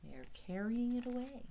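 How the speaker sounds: usual speaking voice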